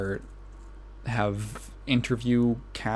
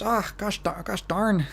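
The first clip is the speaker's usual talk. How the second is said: Midwestern gosh darn; 8 semitones higher than usual. Real, not acted. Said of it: "a really heavy Midwestern accent".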